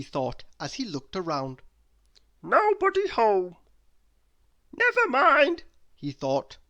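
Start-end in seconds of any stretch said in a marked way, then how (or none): 2.4-5.6 very high, loud, daft man's voice; a high, loud, dotty "oh dear"; silly Mister Muddle. A narrator's reading. Mister Muddle finding nobody home, high and loud.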